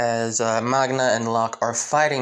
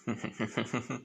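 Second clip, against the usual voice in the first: villain chuckle; 12 dB quieter than usual. Acted. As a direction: chuckle softly with sinister relish. Villain laughs before the fight.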